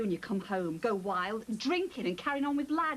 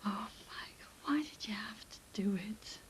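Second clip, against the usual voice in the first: sad whisper; 4 semitones lower, 8 dB quieter, slower than usual. Acted. Mother's disappointed lament.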